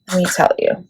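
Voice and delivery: normal talking voice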